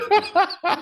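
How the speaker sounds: usual speaking voice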